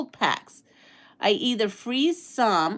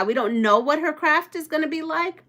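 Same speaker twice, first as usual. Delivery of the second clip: ordinary talk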